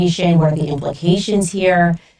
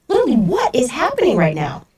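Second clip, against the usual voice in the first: disbelief; 6 semitones higher, faster than usual. Real, not acted. Reacting to the story.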